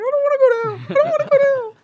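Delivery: squeaky, very high, loud panicked wail; terrified kid. Retold or imitated. Kid fleeing the devil in a dream, shrieking high in terror. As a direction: shriek it like a scared little kid. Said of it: "I'm like".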